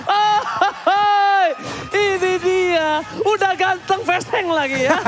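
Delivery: high laughing whoop; laughing shout. Real, not acted. Laughing hype call.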